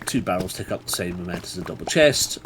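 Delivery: ordinary talk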